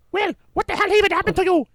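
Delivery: high, fast shrieking demand; angry wife yell. Retold or imitated. Wife Rosa confronting Will at home, high fast shrieked demand. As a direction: shriek at him in disgust.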